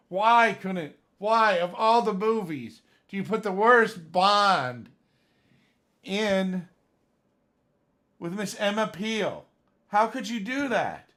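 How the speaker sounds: loud, high and indignant, slowing on the worst Bond; annoyed rant. Real, not acted. Fan complaining about Bond casting, loud annoyed rant. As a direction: vent real exasperation at the casting. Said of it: "that really annoyed me".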